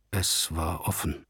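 low, slow, hushed murmur; quiet, low-pitched near-whisper. A narrator's reading. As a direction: say it very softly and slowly. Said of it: "flüsterte Toni vom Boden".

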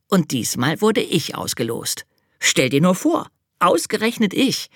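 high, eager little angel; child-like angel explaining. Acted. Angel character voice.

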